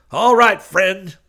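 high, very loud threat; trembling outburst. A narrator's reading. Preacher shouting from fifteen feet away, trembling outburst.

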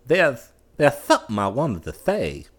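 high, loud halting start; fumbling. A narrator's reading. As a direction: trip over the opening words nervously. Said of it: "he fumbled".

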